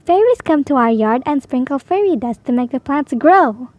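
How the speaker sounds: ordinary delivery, nothing marked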